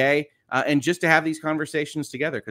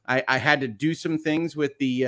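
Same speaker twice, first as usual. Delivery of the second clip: normal talking voice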